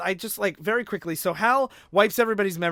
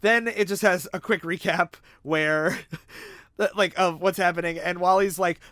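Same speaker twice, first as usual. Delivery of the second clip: ordinary delivery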